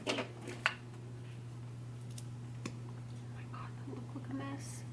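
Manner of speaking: breathy hushed whisper; horrified whisper; whispered dismay. Real, not acted. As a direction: whisper it, appalled. Dismay at her mascara.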